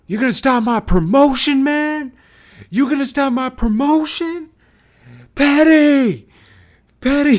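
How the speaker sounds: very high, loud imitation of a furious promoter; enraged yelling. Promoter rages over a withheld video, furious yelling. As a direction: scream in outrage, hammed-up. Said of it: "he starts swearing at me".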